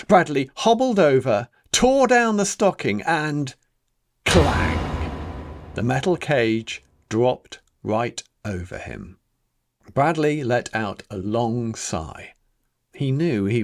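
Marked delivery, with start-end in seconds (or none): none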